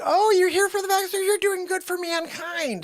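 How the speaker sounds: high, quick gushing imitation; put-on staff greeting. Staff welcome Joanne for her shot, mock gushing voice.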